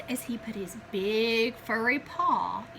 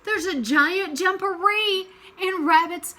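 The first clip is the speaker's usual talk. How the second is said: higher, louder urgent report; alarmed telling; 6 semitones higher, 8 dB louder than usual. Acted. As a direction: gasp it out in a fright.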